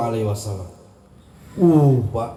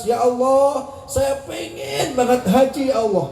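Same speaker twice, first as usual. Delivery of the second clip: high-pitched, imitated weeping prayer; retold tearful plea; 8 semitones higher than usual. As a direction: plead to God as if crying, pitched up.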